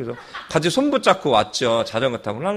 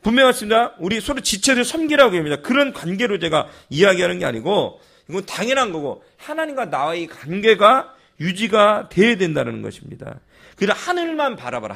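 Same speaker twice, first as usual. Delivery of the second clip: raised, high preaching voice; 6 semitones higher than usual. Acted. Pastor preaching to congregation.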